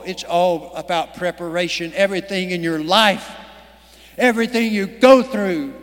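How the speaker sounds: usual speaking voice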